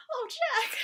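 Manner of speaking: high, loud and teary; fond sigh. Retold or imitated. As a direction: melt over the name. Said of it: "It's like".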